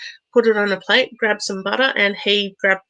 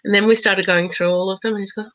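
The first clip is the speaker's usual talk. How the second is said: usual speaking voice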